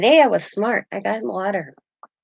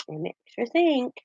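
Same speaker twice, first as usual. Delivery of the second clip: "It makes you think" said much softer; soft echo; 4 semitones higher, 6 dB quieter than usual. Real, not acted. Echoing her own thought to viewers, softly. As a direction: let "think" fall away quietly.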